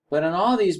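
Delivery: ordinary delivery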